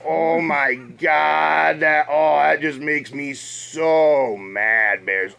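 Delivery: slow, low mock picture-book sorrow; storybook reading voice. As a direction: read it like a sad bedtime page. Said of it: "read a little thing called I Was So Mad".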